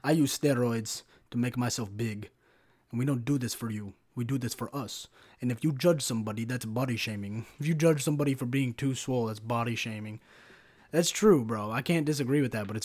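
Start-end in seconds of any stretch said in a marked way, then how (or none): 0.0-10.2 low, deliberate mock Russian accent; Russian-style accent. Big gym guy defending his physique, Russian-style accent. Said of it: "That was, like, more Russian".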